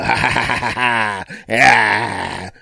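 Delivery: loud jeering laugh; mocking cackle. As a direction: cackle like a smug bully. Mean laugh after insult.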